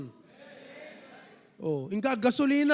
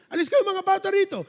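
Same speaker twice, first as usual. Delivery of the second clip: put-on higher, louder shooing of the children; shooing shout; 9 semitones higher, 6 dB louder, faster than usual. Disciples shouting the children away from Jesus, loud shoo. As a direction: drive the kids away with a sharp yell. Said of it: "sabi ng mga disipulo".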